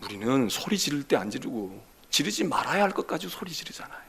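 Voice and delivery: ordinary delivery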